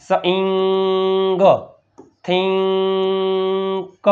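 very slow, ringing nasal hold on ઈંગ before the final sound; drawn-out ઈંગ. Shown as a demonstration. Teacher showing pupils the sound, ઈંગ stretched long. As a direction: hold the ઈંગ in સ ઈંગ and થિંક on one long note. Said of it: "સિંગો એટલે કે ઈંગો".

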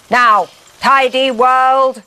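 robot declaring it will tidy the world; menacing robot. A narrator's reading. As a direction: announce conquest like a machine tyrant. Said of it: "robot declares next goal".

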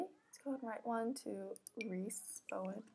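soft conspiratorial whisper. Real, not acted. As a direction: whisper it like a secret. Playful secret love email.

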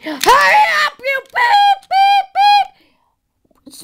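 very high yelling with squealed beeps; play road rage. Pretend. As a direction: shriek at traffic, bleeping the swearing. Acting a cursing, honking driver.

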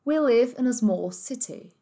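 slow, clipped British English, no r; British accent. Shown as a demonstration. Teacher showing students the British accent, slow and clear. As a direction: give it the British sound. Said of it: "Britânico".

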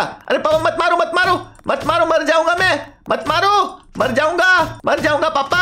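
high, mock-frantic begging; hammed-up panicky kid voice; pleading son. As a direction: plead with papa to stop, over and over. Son begs papa to stop shooting.

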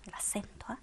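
a voiceless hint about the accent; whispered reminder. Shown as a demonstration. Teacher whispers a reminder about the accent mark.

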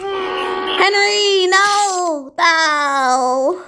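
high, anguished cry of the name. Real, not acted. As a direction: yell at the screen in dismay. Reacting to Henry's failure.

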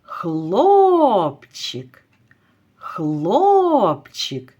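хлопчик with a long, falling О; calling out. Shown as a demonstration. Teacher has pupils call the boy, drawing out хлопчик's О. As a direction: call хлопчик, stretching the first syllable. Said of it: "хлопчика спробуйте покликати".

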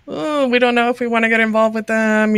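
mock a wary, noncommittal refusal; reluctant hedging. Churches wary of survivor-led ministries, imitated as reluctant.

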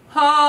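loud held low ha; low sustained ha. Shown as a demonstration. Coach demonstrating a low sustained note. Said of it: "Sustain as low as you can go".

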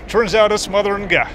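ordinary delivery

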